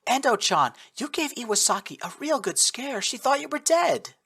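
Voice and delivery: put-on high, bright-eyed girlish voice; excited young woman. A narrator's reading. Squadmate greets the rescued girl, high and excited. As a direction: gush at a found friend.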